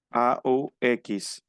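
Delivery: letter by letter, slowly; spelling letters. Shown as a demonstration. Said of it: "La pronunciación es o". (deletreo lento)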